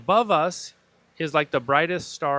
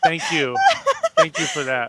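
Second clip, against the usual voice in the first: squeaky high laughing thanks; mock gratitude, cracking up; 6 dB louder than usual. Real, not acted.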